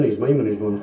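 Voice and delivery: normal talking voice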